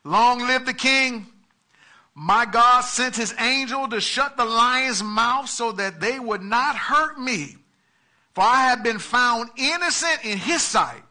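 proclaiming answer. A narrator's reading. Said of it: "Daniel answered".

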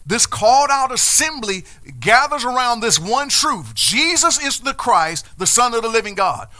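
loud, high, proclaiming; full-throated sermon proclamation; preaching at full force. Acted. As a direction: declare it to the church with power. Preaching climax.

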